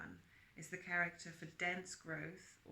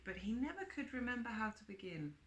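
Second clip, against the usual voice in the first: usual speaking voice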